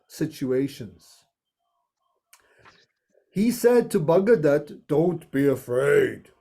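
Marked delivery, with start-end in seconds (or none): 4.9-6.3 deep Krishna voice. Acted. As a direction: speak as Lord Krishna, low and calm. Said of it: "This is Krishna's voice now".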